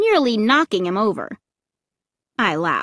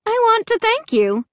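high, cheery show hostess; 13 semitones higher, 3 dB louder than usual. A narrator's reading. Park MC thanking her captive guests, cheery. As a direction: greet them in a high, chirpy voice. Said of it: "she said in a cheery voice".

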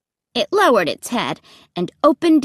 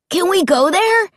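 put-on high, eager boy pestering; excited kid's pushy question; eager demand; 9 semitones higher, 4 dB louder than usual. A narrator's reading. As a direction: beg to go, pitch way up. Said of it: "Luke demanded eagerly".

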